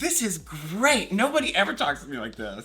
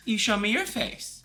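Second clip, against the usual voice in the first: higher-pitched, insistent mock demand; retold firm demand; 6 semitones higher than usual. Retelling a demand to hidden caller, insistent. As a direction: press each word as an order. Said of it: "I was very like".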